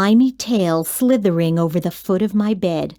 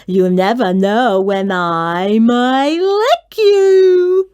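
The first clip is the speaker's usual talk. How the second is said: slow, louder, hammed-up menace; mock terrifying monster; 4 dB louder, slower than usual. A narrator's reading. Mac tries to scare the child, slow hammed-up menace. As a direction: play at being scary, a touch ridiculous. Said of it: "trying to sound terrifying".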